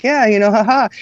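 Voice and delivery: lightly voiced laughing boy; mocking giggly kid. Son laughing off his classroom joke, careless giggle. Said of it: "he smiled, like".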